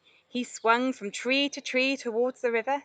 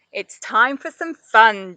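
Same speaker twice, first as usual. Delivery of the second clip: loud, slow announcement; announcing; 9 dB louder, slower than usual. A narrator's reading. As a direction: proclaim the fun to everyone. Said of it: "Jemmy announced".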